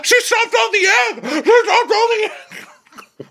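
very high, drawn-out mock wail; crying little-boy tantrum. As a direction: whine it like a little boy in meltdown. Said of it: "literally crying like a little boy".

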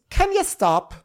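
very high-pitched exasperated plea; mock annoyance. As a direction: squeal it like a fed-up mother. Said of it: "gets annoyed and goes".

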